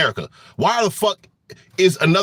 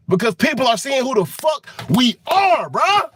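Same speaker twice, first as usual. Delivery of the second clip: shouting, pitched higher; angry shout; 5 semitones higher than usual. Real, not acted. Warning viewers about America's reputation, angry shout. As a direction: yells it out in frustration.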